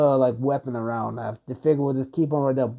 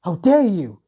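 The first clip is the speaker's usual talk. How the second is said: higher, louder furious outburst; angry; 5 semitones higher, 7 dB louder than usual. Acted. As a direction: hurl it at the demon in rage. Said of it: "he would just be angry".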